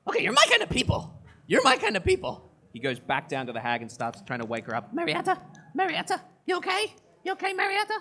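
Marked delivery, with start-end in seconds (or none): 0.1-2.5 very high, fast, loud manic glee; manic delight. Acted. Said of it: "manic dryad kind of looks and says". 4.9-7.9 very high, anxious calling; worried dryad. Acted. Dryad trying to wake the hag.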